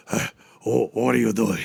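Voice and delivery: a hint of a sloppy midnight call; drunk-dial call. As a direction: mumble into the phone like a lonely ex. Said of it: "calling her up in the middle of the night".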